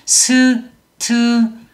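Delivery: 스트라이크 as seu-teu-ra-i-keu, slow separate beats; five-syllable Korean-style. Shown as a demonstration. Teacher shows the mistaken count, five-syllable 스트라이크. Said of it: "오 음절 이렇게 생각하실 수".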